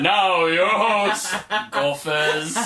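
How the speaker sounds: slow booming announcer voice; hammed-up game show host; announcer intro. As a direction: drag it out like a cheap TV intro. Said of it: "So it's like".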